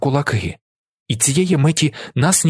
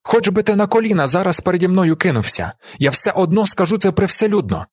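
high-pitched, insistent outburst; defiant shouting; 8 semitones higher than usual. A narrator's reading. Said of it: "кричить він Альберту".

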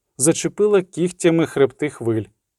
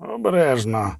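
measured word of warning; cautioning. A narrator's reading. Vesemir cautions Geralt, a restrained warning. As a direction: warn them, understated. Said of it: "застеріг Весемір".

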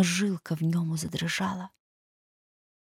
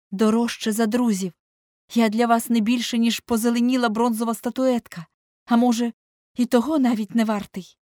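raised, louder, emphatic reproach; seething irritation; 5 semitones higher, 5 dB louder than usual. A narrator's reading. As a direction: throw the hurt accusation at the painter. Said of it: "мало не кипів від роздратування". (роздратовано, з докором, підвищеним голосом)

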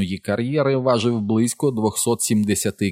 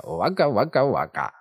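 clown it with a bouncing pitch; comic catchphrase; 2 semitones higher than usual. A narrator's reading. Richie repeats his comic catchphrase.

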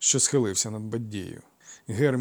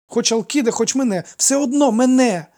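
put-on high, loud boyish insistence; excited high-voiced declaration; blurting out; 9 semitones higher, 9 dB louder than usual. A narrator's reading. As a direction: pour it out triumphantly like a child. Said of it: "випалив він".

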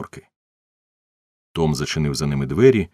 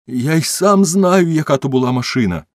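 crying out; 10 semitones higher, 6 dB louder than usual. A narrator's reading. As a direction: shout it out in distress, voice way up. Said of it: "закричав він". (розпачливий крик)

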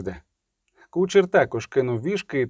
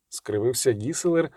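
ordinary delivery

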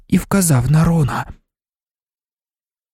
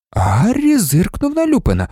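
ordinary delivery

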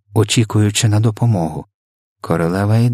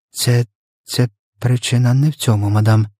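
halting, nervous maid's reply; trembling voice; 2 semitones higher than usual. A narrator's reading. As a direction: stammer the first word, uneasy and trembling. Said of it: "Голос покоївки страшенно тремтів". (тремтячий, схвильований голос)

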